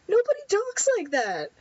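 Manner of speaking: high-pitched, exasperated disbelief; incredulous mocking. Real, not acted. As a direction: scoff at badly written dialogue. Mocks the game's stilted dialogue.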